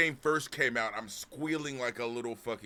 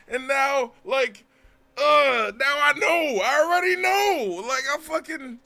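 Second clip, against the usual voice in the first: higher, louder ranting in outrage; still furious; 14 semitones higher, 11 dB louder than usual. Retold or imitated. Telling friends about the ruined plot, furious ranting. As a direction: keep yelling as you tell it. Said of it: "I'm so fucking mad".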